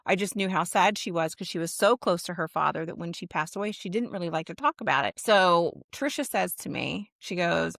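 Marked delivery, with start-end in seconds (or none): none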